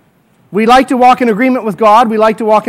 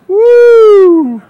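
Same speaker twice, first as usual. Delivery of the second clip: high, loud whoop with a laugh; excited whoop; 11 semitones higher, 6 dB louder than usual. Pretend. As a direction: whoop like you just won. Car owner thrilled by gift.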